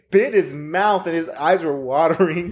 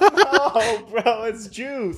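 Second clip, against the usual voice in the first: higher, drawn-out laughing plea; retold protest; 6 semitones higher, much slower than usual. Boy explaining his wet pants to schoolmates, mock pleading. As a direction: insist on it, pitched up and stretched.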